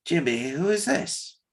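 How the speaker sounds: small, soft, distant little voice; a tiny creature speaking up. Acted. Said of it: "you hear a tiny voice say".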